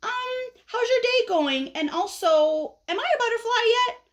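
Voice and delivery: high voice, stalling then rushing; pestering caterpillar. Acted. Caterpillar finally allowed to ask, pestering. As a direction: start innocently high, then rush the real question. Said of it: "And so this guy says".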